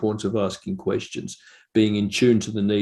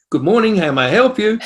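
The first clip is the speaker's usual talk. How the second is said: much higher, louder mock receptionist greeting; phone greeting; 11 semitones higher, 7 dB louder than usual. Son shows how to answer every phone call, much higher and louder. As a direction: chirp it like answering a business phone.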